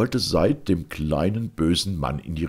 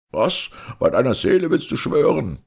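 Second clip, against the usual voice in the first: higher, louder outraged disbelief; merchant's shout; 8 semitones higher, 4 dB louder than usual. A narrator's reading. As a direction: throw it back as an indignant outcry. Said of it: "schrie der Kaufmann".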